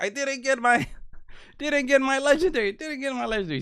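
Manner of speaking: high, loud mock tantrum; mad boss. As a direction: wail it like a furious boss who got cheated. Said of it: "he's, like, mad".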